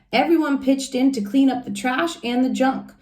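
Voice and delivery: plain reading voice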